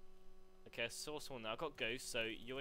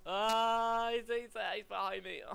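high, loud startled cry into stammering; yelp, then stammer the warning; panicked yell; 8 semitones higher, 9 dB louder, slower than usual. Real, not acted. Gamer finds an enemy behind him, panicked.

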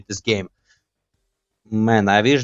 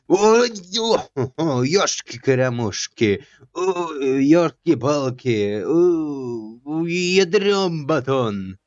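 mock Russian exclaims; 3 semitones higher, much slower than usual. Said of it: "a Russian word".

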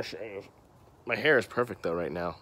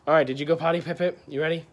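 high, loud pet voice; talking to the dog; 7 semitones higher, 5 dB louder, faster than usual. Real, not acted. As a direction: coax the dog sweetly. Talking to his dog Pip Pip.

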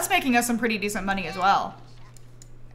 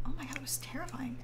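whisper; 15 dB quieter, faster than usual. Real, not acted. Reacting quietly.

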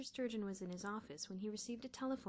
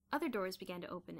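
ordinary delivery

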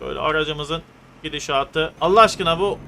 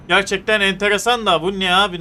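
usual speaking voice

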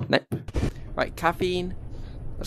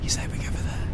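whisper; 9 dB quieter, faster than usual. Acted.

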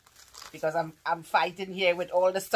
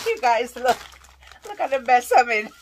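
louder, higher, half-laughing; laughing exclaim; 6 semitones higher, 9 dB louder than usual. Real, not acted. Showing viewers her packaging mess, a loud laughing exclaim. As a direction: cry it out amused at yourself.

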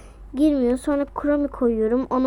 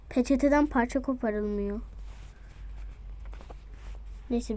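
ordinary delivery

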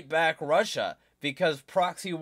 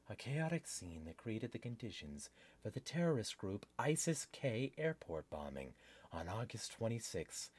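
low, very soft reading voice; article read-aloud; 9 semitones lower, 15 dB quieter than usual. Real, not acted. Host reads news article to viewers, quiet read-aloud.

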